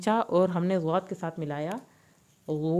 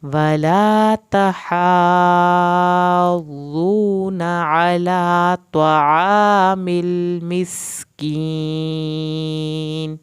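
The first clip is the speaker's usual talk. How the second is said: slow, louder, drawn-out melodic tajweed recitation; Quran recitation; 8 dB louder, much slower than usual. Shown as a demonstration. Teacher reciting the full verse for students, slow melodic recitation. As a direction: chant it out full and slow, stretching the long vowels.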